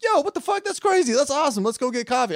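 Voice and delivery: very high, loud mock enthusiasm; over-excited reaction. Acting out delight at meeting someone French, hammed-up excitement. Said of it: "I'm like".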